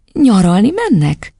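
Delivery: lightly voiced surprised woman; puzzled teacher. A narrator's reading. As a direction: ask the child gently, drawn out. Teacher questions the girl.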